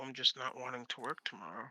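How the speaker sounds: normal talking voice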